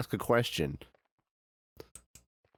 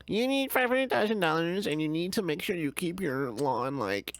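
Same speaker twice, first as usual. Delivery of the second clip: mocking HOA voice; 7 semitones higher than usual. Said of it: "be like".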